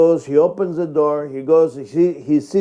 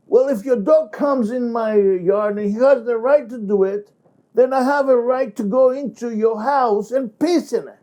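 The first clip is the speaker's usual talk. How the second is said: high, heated angry reasoning; freaking out; 9 semitones higher than usual. Shown as a demonstration. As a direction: work yourself up into a rage. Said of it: "John will freak out".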